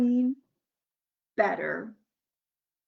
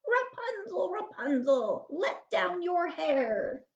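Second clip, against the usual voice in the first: witch calling; 6 semitones higher than usual. A narrator's reading. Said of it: "he heard her call out".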